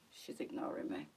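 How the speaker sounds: teasing whisper. Real, not acted. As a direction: hush it to the dog. Teasing the dog quietly.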